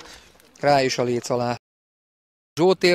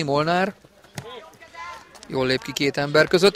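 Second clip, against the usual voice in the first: ordinary delivery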